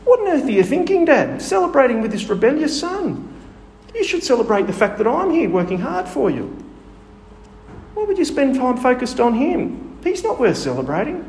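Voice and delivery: resentful son protesting to his father, bitter; vitriolic outburst. Retold or imitated. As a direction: let the jealous son rage at the party. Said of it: "You can hear the vitriol in his voice".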